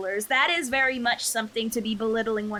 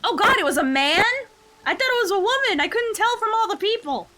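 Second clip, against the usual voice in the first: loud, higher burst of disbelief; loud surprised outburst; real surprise; 10 semitones higher, 6 dB louder than usual. Real, not acted. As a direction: blurt it out, genuinely thrown. Surprised reaction at character's gender.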